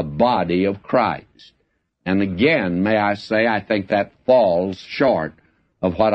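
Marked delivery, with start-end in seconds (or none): none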